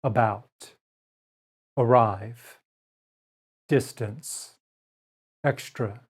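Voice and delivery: unstressed A in "About" said as uh; careful word list, slow; schwa demo words. Shown as a demonstration. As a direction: model each word with a weak uh. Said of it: "Keep in mind that this A".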